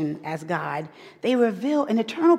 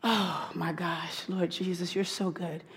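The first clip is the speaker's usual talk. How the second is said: hushed aside to God; 3 semitones lower, 9 dB quieter than usual. Real, not acted. Aside praise to Jesus mid-sermon.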